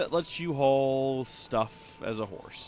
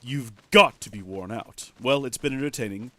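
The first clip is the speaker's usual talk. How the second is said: ordinary delivery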